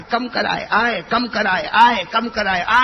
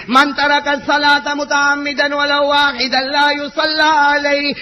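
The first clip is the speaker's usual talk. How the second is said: slow, measured Arabic quotation; Arabic recitation; 8 semitones higher, 8 dB louder, much slower than usual. Acted. Preacher reciting the saint's Arabic fatwa, slow chant-like. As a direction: intone the Arabic words deliberately.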